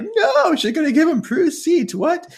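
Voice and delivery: high, bursting disbelief with a laugh at the end; shocked protest. Retold or imitated. Piper protesting that Prue's seat is being given away, high and shocked. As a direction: squeal it out as if you can't believe it.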